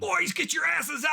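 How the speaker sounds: high, loud shout from above; overheard yell. Acted. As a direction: holler down to the boys. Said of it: "You hear".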